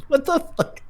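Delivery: squeaky high mock outrage; high shocked yelp at dad; high-pitched disbelief. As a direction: shoot way up in pitch, incredulous. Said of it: "I was like".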